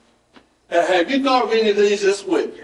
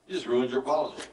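normal talking voice

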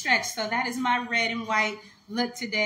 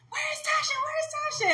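put-on much higher, anxious mom calling for her child. Mother searching the crowd for her little girl, high anxious call. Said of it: "my mom saying".

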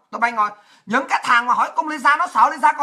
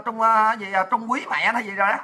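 ordinary talk, nothing marked

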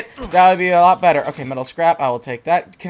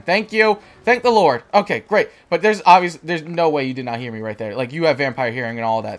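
ordinary talk, nothing marked

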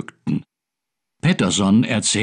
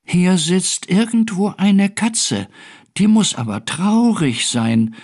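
higher storybook creature voice; little creature; 6 semitones higher than usual. A narrator's reading. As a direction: draw it out as a curious small being. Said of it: "sagte plötzlich eine Stimme".

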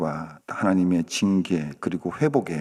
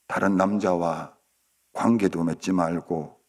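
slow measured plea, softening at the close; tender, solemn husband; 3 semitones lower than usual. Acted. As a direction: speak a vow gently, with long pauses. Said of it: "꼭 안아주면서". (천천히 다정하게 당부하는 말투)

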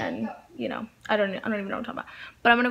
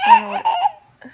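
laughing; 11 dB louder, slower than usual. Real, not acted. Laughs mid-sentence with kids.

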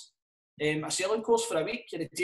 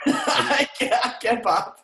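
laughing; 5 semitones higher, 6 dB louder, much slower than usual. Real, not acted. Cracking up at joke.

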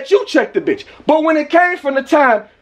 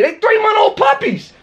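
higher-pitched, incredulous yell; raised voice, indignant; outraged shout; 6 semitones higher than usual. Real, not acted. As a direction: shout it in disbelief. Outraged at neighbor's claim.

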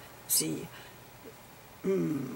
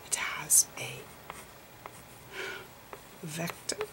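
soft unvoiced whisper, explaining gently; whispered lecture; 2 semitones lower, 5 dB quieter than usual. Lecturer explaining photon slices to viewers, whispering.

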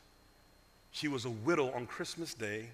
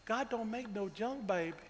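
a hint of a sweet old Mississippi teacher; old Southern lady; 5 semitones higher, 7 dB quieter than usual. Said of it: "That's how she said it".

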